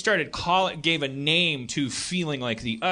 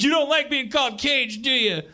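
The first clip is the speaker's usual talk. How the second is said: teasing; 7 semitones higher than usual. Retold or imitated. Bikers teasing Cage.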